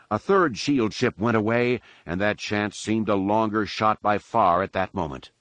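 ordinary delivery

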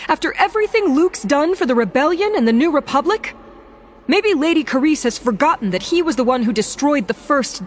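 high, loud furious outburst; sharp, shrill. Acted. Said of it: "her temper had gotten the best of her".

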